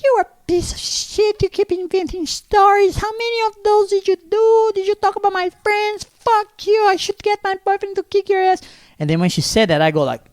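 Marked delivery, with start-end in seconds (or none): 0.0-8.6 very high screechy falsetto rant; mock shrieking fury. Imitating the girl cursing Richard before her father, mock shrieking. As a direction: screech it like an outraged girl, hammed up.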